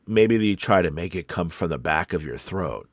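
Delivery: ordinary delivery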